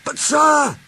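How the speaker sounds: Donald bursting out. A narrator's reading. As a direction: blurt it eagerly. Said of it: "burst out Donald".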